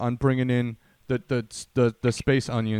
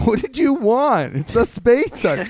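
mock exasperated; 7 semitones higher, 5 dB louder, slower than usual. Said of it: "I said already".

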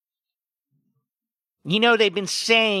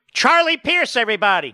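much higher, louder, game-show intro; sarcastic big introduction, much higher and louder; mock announcer; 8 semitones higher, 4 dB louder than usual. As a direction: announce him like a circus act.

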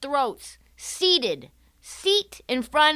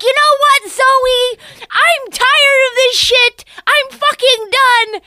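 much higher, louder, slowing into a furious blow-up; angry outburst; 13 semitones higher, 10 dB louder, slower than usual. Retold or imitated. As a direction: boyfriend snapping at his partner at dinner, shouting. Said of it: "He's like".

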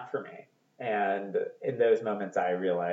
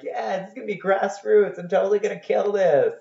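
cocky excitement; 10 semitones higher, 3 dB louder than usual. Retold or imitated. Retelling his confident younger self.

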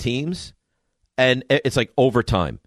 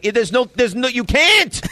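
exasperated; 11 semitones higher, 4 dB louder, slower than usual. Retold or imitated. Exasperated coach to a player.

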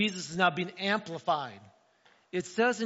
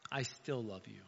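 soft, low and gentle, much quieter; God's tender voice; 8 semitones lower, 10 dB quieter than usual. Retold or imitated. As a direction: reassure like a loving father. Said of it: "God says".

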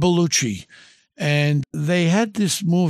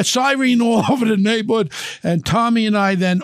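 usual speaking voice

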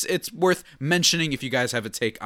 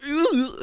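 effort grunt; 3 dB louder than usual. Retold or imitated. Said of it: "I kinda go".

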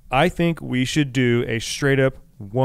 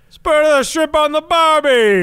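hammed-up Australian accent, higher, louder and slow; 14 semitones higher, 8 dB louder, slower than usual. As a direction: do a big cartoon Australian saying the barbie line.

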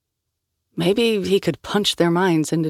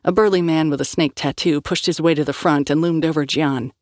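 ordinary delivery

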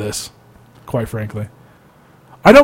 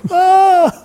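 high mock shriek; 3 dB louder than usual. Satan's exclamation in retold sketch.

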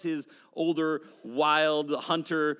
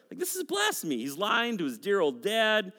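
ordinary talk, nothing marked

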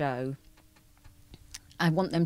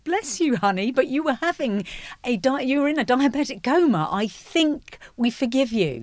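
high, loud affectionate teasing; fond mock scolding; 8 semitones higher, 5 dB louder than usual. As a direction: coo at him like a sweetheart. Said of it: "We're like".

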